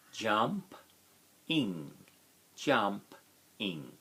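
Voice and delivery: jump, ing split into two beats; split into syllables. Shown as a demonstration. Teacher splitting jumping into its syllables for the class. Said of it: "Two syllables".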